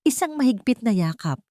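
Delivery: ordinary delivery, nothing marked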